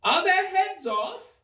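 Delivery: high, slow imperious shout; queen shouting. A narrator's reading. Queen demands news of execution, high shout. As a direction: bellow down the garden. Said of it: "shouted the queen".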